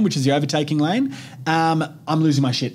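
ordinary talk

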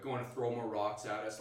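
ordinary delivery

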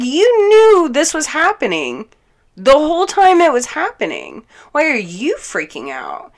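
high, loud, heated retold reproach; outraged accusation. Accusing him of knowing all along, outraged. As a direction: act out accusing him, pitch way up.

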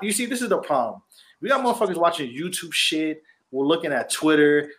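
normal talking voice

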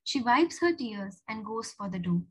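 usual speaking voice

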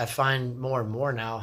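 ordinary talk, nothing marked

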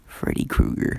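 whisper. Real, not acted. Joking suggestion, unvoiced.

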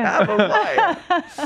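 high, slow, laughing. Pretend. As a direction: stretch it out, laughing. Mock proud repeat.

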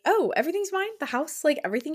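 high-pitched, feigned disbelief at the windfall; cocky mock surprise. Heiress hearing she gets everything, cocky mock surprise. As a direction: play cocky innocence, starting high. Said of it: "She's being so cocky".

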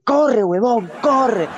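high urgent yell, half laughing; urgent shout. Retold or imitated. Friend yells at Renzo to run for the bus, laughing urgent shout. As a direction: shout him into a sprint. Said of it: "le dije".

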